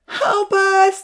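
high, loud plea for help; pleading. A narrator's reading. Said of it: "they pleaded".

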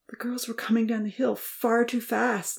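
whispered, trembling telling; shaken explanation. A narrator's reading. Uncle explaining the crash, shaken whisper. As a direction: barely get the words out. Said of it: "Louis tried to explain".

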